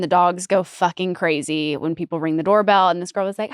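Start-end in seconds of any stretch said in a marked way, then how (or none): none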